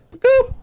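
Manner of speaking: squeaky cartoon "ba-goop"; high-pitched mask-slipping noise. Showing an old-school mask going on, cartoon noise. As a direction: pop it out high like a sound effect.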